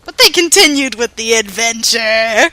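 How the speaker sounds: loud, rousing story announcement; grand narrator outburst. Pretend. Narrator shoves the story onward, loud and grand. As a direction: belt it out to restart the tale.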